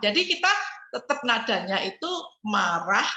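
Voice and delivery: normal talking voice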